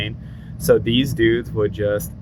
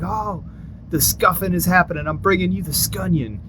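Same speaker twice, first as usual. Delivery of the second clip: higher, lightly mocking instructor voice; mock drill sergeant; 7 semitones higher than usual. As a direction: lord it over the trainees, playfully. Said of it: "He'd be like".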